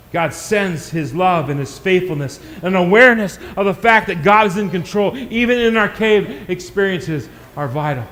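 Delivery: normal talking voice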